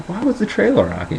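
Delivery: very high, small questioning voice; high child voice. Retold or imitated. Seven-year-old questioning grandpa, high mock child voice.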